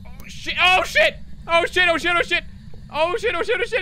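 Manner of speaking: panicked loud yelling; being chased. Real, not acted. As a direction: scream while fleeing for life. Fleeing ghost, repeated cries.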